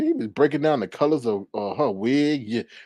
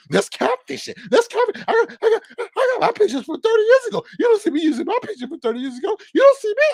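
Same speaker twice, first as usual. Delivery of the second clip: high, louder mock rant, rushed at the end; mocking his rant; 6 dB louder, faster than usual. Streamer mimicking the angry man complaining about old pictures, high mock rant. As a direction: ham up his outraged whining, pitched way up.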